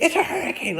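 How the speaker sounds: high panicked squeal; mock-panicked traveler; imitating panicked passenger. A passenger squealing at the couple, high panic.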